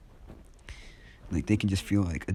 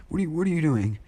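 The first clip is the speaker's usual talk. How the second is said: much higher, stumbling frantic question; mock panic; 9 semitones higher, 3 dB louder, faster than usual. Bakugo on the ground questions Izuku, panicked. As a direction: act out alarmed disbelief.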